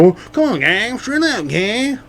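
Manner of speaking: high mocking Trump voice. Mocking how Trump sounded on South Park. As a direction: scold the gang, high and teasing. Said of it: "That's what he sounded like".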